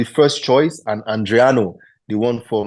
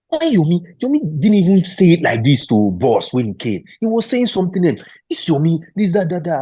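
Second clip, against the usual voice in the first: higher-pitched, disbelieving objection; retold protest; 8 semitones higher, 3 dB louder than usual. Assistant coach questioning the player's version of events, retold protest. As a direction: push back in a raised, incredulous voice.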